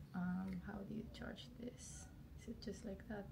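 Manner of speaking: breathy whispered question to herself. Real, not acted. Vlogger whispering to herself while working out the charger. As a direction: whisper it so nobody wakes.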